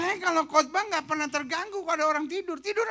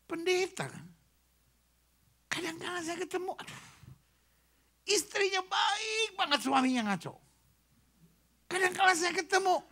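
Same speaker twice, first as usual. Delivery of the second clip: usual speaking voice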